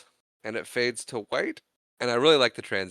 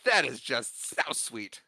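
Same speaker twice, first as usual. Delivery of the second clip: drip with sarcasm over an unwanted gift; sarcastic; 4 semitones higher than usual. Retold or imitated. Henry mocks the gift from Marlon, sarcastic. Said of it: "and he's like".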